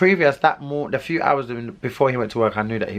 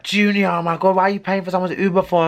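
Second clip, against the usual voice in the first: higher, louder and fast, mock disbelief; incredulous friend; 8 semitones higher, 4 dB louder, faster than usual. Friend scolding Junior for paying his Uber, incredulous fast mock. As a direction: imitate your friend who can't believe it, rapid-fire.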